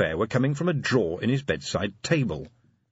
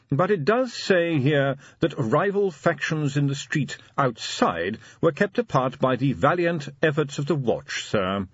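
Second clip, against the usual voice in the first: higher, measured butler voice; butler reporting respectfully; 7 semitones higher than usual. A narrator's reading. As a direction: report formally to the master. Butler reads the paper aloud.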